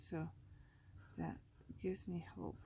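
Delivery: ordinary delivery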